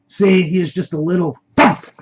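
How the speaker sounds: loud, high taunting gloat. A narrator's reading. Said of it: "gloatingly said".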